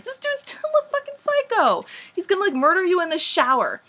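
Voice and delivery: hammed-up shrill warning; mock alarm. Real, not acted. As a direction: squeal it like a horror fan.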